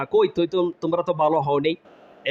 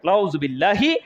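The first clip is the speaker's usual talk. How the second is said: ordinary talk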